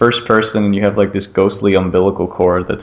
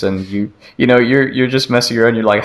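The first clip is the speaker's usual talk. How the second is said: normal talking voice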